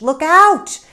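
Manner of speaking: loud, high yell of alarm; sudden full-voiced "Look out!"; warning shout. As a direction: shout a warning across the beach. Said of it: "shouts echoed from the beach".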